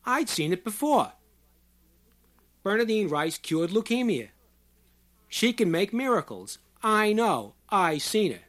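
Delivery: high, loud testimony; emphatic shouting. A narrator's reading. Short man declaring her miracles to onlookers, emphatic shouting. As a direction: proclaim it loudly to the whole crowd. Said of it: "But the short man continued".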